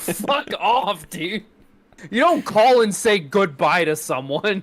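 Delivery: a hammed-up outrage that breaks into laughter; mock indignant. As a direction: tell him off, laughing in disbelief. Indignant scolding, retold.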